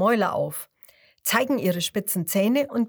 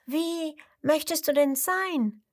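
much higher little crocodile voice; 8 semitones higher than usual. A narrator's reading. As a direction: ask back in a tiny high voice. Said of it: "fragt das kleine Krokodil zurück". (kleines Krokodil fragt hoch zurück)

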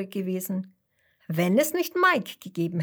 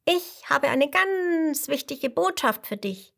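higher little insect voice; tiny mosquito voice; 6 semitones higher than usual. A narrator's reading. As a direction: speak high like a tiny mosquito. Mosquito speaks.

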